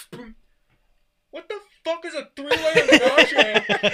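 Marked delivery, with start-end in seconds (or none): 1.3-3.9 a loud, slow, laughing yell; slow yell through laughter. Retold or imitated. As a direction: bellow it while cracking up. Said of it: "we just hear".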